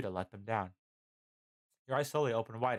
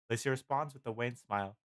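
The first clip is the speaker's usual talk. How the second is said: plain reading voice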